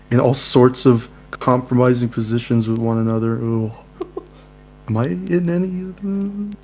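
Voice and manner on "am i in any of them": high put-on character voice. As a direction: ask in a high squeaky voice. Player voices character asking.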